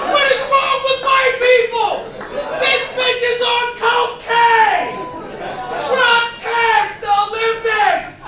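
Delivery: loud, slow shouted disbelief; comic outrage, shouting slowly; outraged shout. Real, not acted. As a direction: rant it in disbelief at the crowd. Outraged rant about Olympics boxer.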